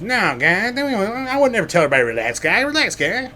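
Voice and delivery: high mocking Trump voice. Mocking Trump answering Satan about the list. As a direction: brush it off in a high voice. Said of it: "And Satan's like".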